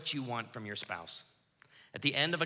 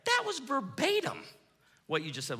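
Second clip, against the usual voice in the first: 1 indignant protest; 10 semitones higher than usual. Retold or imitated. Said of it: "And you're like".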